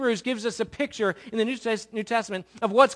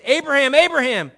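loud, repeated call of the name; 4 semitones higher, 7 dB louder than usual. Acted. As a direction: call him twice to stop his hand. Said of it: "the angel of the Lord called out to him".

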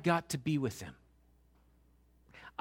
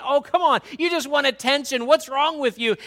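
higher, louder jeering brothers; mocking older brothers; 9 semitones higher, 8 dB louder than usual. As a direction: sneer at the little brother. Mocking brothers voiced in story.

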